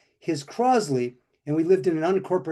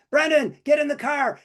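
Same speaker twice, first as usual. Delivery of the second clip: high-pitched urgent call to a son-in-law; retold yell; 10 semitones higher than usual. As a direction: yell it as if calling someone into a moving car, not full force. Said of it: "I yell at him".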